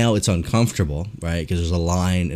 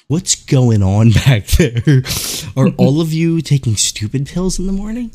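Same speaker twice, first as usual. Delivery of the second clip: raised pitch, mock-exasperated questioning; exasperated customer; 5 semitones higher, 4 dB louder than usual. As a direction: act out an incredulous complaint to the staff. Imitating exasperated customers at staff.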